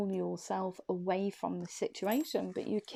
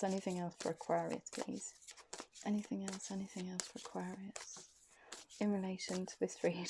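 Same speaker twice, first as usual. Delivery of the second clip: quiet asking; 9 dB quieter than usual. Real, not acted. Asking spirit guides quietly.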